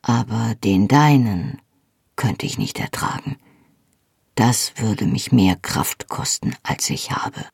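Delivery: low male voice fading to a breath; Jamie, low and tender. A narrator's reading. Jamie's voice, emotional confession.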